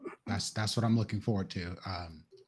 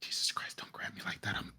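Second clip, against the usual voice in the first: hushed, tense hiss of fright; startled whisper; 4 semitones lower, 9 dB quieter than usual. Acted. Franklin startled by Alina grabbing him, whispering. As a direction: whisper your shock without being heard.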